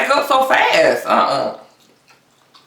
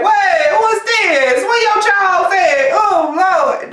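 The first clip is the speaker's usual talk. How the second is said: shocked at own reflection; 10 semitones higher, much slower than usual. Retold or imitated. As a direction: shriek at the mirror in mock horror.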